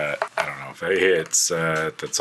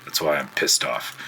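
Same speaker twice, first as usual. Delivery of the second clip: normal talking voice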